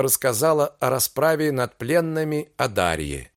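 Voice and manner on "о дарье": ordinary delivery, nothing marked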